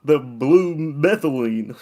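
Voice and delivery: high, drawn-out put-on voice; hammed-up rambler; mock crackhead. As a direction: stretch it slowly in a silly character voice.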